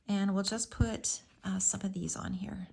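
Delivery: whispered while concentrating; murmured to yourself, no voice; whispering. Real, not acted. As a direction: drop to a whisper as you work. Whispers while placing dots.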